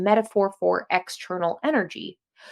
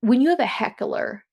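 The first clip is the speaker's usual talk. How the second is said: normal talking voice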